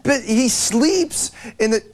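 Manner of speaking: high indignant rant. Acted. Host ranting, high pitched.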